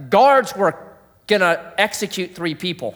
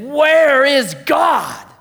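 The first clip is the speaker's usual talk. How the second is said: anguished cry; 9 semitones higher, 5 dB louder, much slower than usual. Retold or imitated. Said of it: "that guy again said".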